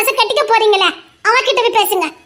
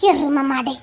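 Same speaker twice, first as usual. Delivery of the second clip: mock insult; 9 semitones lower, 6 dB quieter, slower than usual. Teasing insult at flirting colleague.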